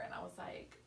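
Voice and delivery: usual speaking voice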